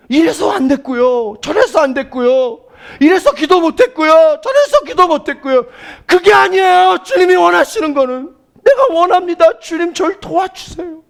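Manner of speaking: high, loud, insistent sermon voice; full-force preaching. Real, not acted. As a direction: build from listed excuses into a forceful plea.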